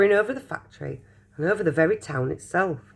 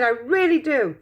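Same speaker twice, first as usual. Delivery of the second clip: higher and rising in glee, building louder; delighted cry; 10 semitones higher, 4 dB louder than usual. Acted. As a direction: gush with joy over Charlie's win. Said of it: "he cried".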